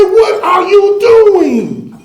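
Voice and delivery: high, loud exasperated shout up from the hole; shouted protest. Acted. Man in the hole yelling at his friend, loud and high. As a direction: shout your disbelief at him.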